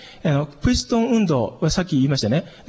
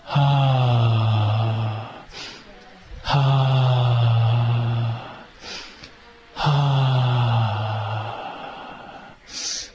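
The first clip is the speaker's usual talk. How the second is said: lower, long, sung "haa" tones; low sung exhale; 6 semitones lower, much slower than usual. Shown as a demonstration. Teacher sounding long relaxed breaths for the class. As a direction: hum the breath out low and steady. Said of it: "ストンと吸って".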